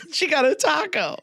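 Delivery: giggling high over the words; laughing through it. Real, not acted. Friends mocking the story's ending, laughing. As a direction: laugh out the punchline.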